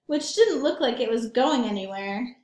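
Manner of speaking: usual speaking voice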